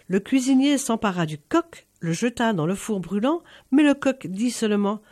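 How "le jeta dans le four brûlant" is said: usual speaking voice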